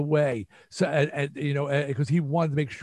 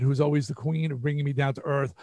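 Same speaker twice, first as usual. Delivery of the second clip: usual speaking voice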